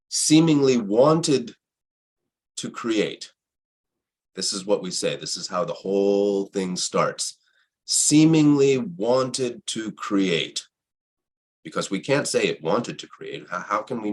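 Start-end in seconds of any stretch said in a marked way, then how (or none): 0.1-3.3 slow, drawn-out and higher; solemn recitation. Shown as a demonstration. Recites how the creation story starts. 7.8-10.7 very slow, higher, drawn-out words; solemn recitation. Shown as a demonstration. Repeats the creation story's opening line.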